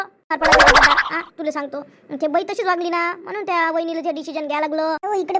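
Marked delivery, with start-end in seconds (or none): 0.0-1.1 loud drawn-out plea. Acted. Pleading loudly with brother.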